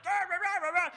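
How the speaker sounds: high, loud gibberish rant; yelling in tongues. Retold or imitated. Comic losing his mind at a heckler, yelled gibberish. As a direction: rattle off nonsense in a mock rage. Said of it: "just started yelling at 'em".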